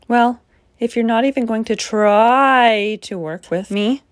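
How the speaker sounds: usual speaking voice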